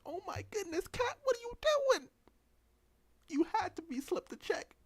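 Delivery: very high-pitched, scolding disbelief; mock dismay. Viewer asking Kat what she is doing, high mock dismay. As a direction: confront in a hammed-up high voice.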